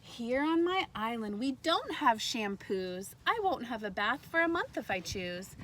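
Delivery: bright, lively read-aloud voice; reading to children. A narrator's reading. Teacher reading a picture book to kindergartners, lively read-aloud. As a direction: read it like story time for little ones.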